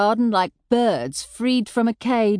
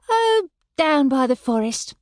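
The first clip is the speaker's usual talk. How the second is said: casual; 6 semitones higher than usual. A narrator's reading. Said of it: "I said casually".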